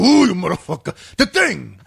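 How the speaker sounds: loud throaty foreman exasperation; guttural. Acted. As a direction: curse gutturally at a clueless worker. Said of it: "Like guttural".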